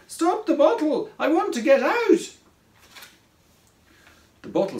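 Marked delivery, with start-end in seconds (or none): 0.1-2.4 high, loud cry of alarm; panicked squeal. A narrator's reading. Craig trapped in the sliding bottle, panicked squeal. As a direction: squeal to be let out. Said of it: "squealed Craig".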